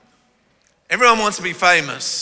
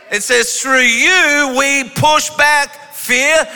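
raised, fervent sermon delivery; preaching; 5 semitones higher than usual. Acted. Pastor preaching Psalm forty-four to the congregation, fervent. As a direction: drive the scripture home to the church.

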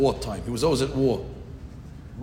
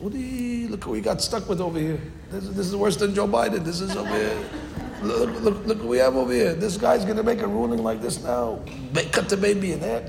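mocking rabbis; 7 semitones higher than usual. Rabbis mocking the boy king.

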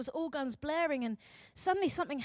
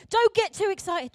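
higher, louder mock admonishment; scolding warning; 6 semitones higher, 8 dB louder than usual. Society warning against big emotion, raised and scolding. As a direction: warn them off sharply.

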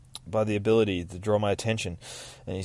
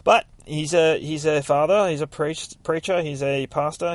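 ordinary talk, nothing marked

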